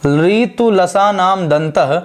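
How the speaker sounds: ऋतुलसानाम दंतः repeated very slowly, ending with a rise; slow recitation, rising. Shown as a demonstration. Teacher repeating the sutra slowly with a rising end. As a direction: drag the sutra out, lift the end. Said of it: "और इसका नाम क्या है?".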